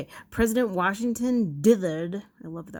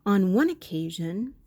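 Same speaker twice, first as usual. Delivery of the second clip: normal talking voice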